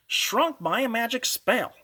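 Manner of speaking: ordinary delivery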